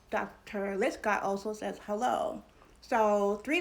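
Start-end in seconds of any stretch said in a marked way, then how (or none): none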